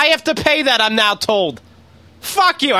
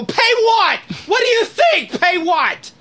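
incredulous yell; slower than usual. Retold or imitated. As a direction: yell it back in disbelief. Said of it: "And I go".

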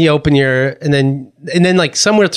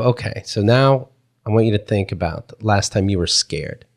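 low, measured guiding voice; seminar leader; 9 semitones lower, 4 dB quieter than usual. Retold or imitated. He retells the leader telling people to think, low guiding voice. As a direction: lead the group in a lowered, softer voice.

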